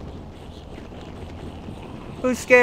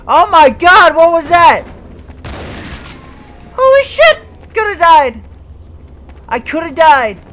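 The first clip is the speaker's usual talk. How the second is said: loud, high panicked exclamations; near-miss panic; 8 semitones higher, 9 dB louder than usual. Real, not acted. Gamer nearly killed, loud high panicked outburst.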